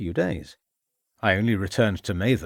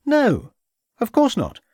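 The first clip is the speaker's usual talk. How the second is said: shocked denial; 12 semitones higher, 4 dB louder, slower than usual. Acted. Shocked suspect denies knowing.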